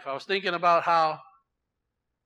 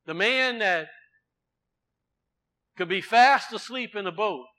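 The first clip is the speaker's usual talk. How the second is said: ordinary talk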